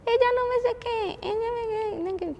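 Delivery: high whiny child, imitated; quejándose. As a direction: mimic a little girl tattling and whining. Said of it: "como quejándose todo el tiempo". (niña quejándose con voz aguda)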